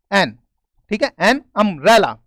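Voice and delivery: ordinary talk, nothing marked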